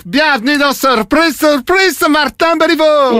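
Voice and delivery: high, drawn-out Québécois game-show reveal; hammed-up Québécois TV host, stretching the names; bad Québécois accent. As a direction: announce the prank in a rotten Québécois accent. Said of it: "mon accent québécois, il est vraiment pourri".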